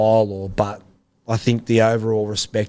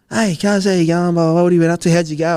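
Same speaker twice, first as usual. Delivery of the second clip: put-on much higher, louder friendly old mate voice; Cliffy's greeting; 8 semitones higher, 4 dB louder, faster than usual. Cliffy greets an old teammate at the fence, high and cheery. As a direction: greet him like a long-lost cousin, pitched way up. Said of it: "He goes".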